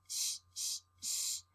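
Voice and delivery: soft unvoiced shh like a mother hushing; gentle whispered shushing; shushing sound. Shown as a demonstration. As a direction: hush a baby, shh shh shh. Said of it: "¿Cómo dice que hace la mami?".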